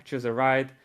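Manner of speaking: ordinary talk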